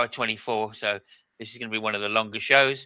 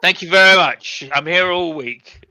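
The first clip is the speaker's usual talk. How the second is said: louder, higher showman's thanks, then drawn out; mock comedian sign-off; 5 semitones higher, 8 dB louder than usual. Host capping his joke to the co-hosts, hammy comedian sign-off. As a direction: take a bow like a club comic.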